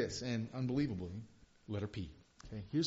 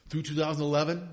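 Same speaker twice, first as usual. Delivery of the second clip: ordinary talk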